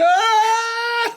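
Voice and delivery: high, loud mock scream of terror; imitating a screaming campmate; imitated scream. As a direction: belt out a short panicked yelp like Paul. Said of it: "All I could hear was Paul going".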